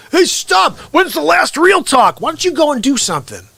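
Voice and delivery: high, exasperated outburst; mock indignation. Host scolding a viewer asking about last show, mock indignation. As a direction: snap back, pitch shooting up in play outrage.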